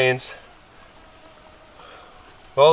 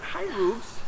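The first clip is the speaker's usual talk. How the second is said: high, soft greeting to the dog; soft high pet voice; 8 semitones higher, 10 dB quieter than usual. Real, not acted. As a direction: coo softly at the pup.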